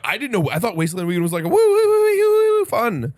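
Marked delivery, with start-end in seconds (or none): 1.5-2.7 high, loud, drawn-out woo-woo; mock festival whoop, long and high; party whooping. Imagining a fun desert festival, party whooping.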